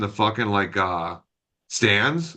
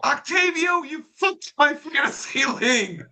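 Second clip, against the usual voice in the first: high-pitched fan's holler, hammed up; mock heckling. Fan accuses pitcher over fantasy league, mock heckling. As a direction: re-enact yelling at a player from the stands.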